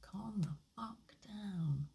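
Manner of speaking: whispering a calm-down word to yourself. Shown as a demonstration. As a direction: breathe the words out slowly in a whisper. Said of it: "that says".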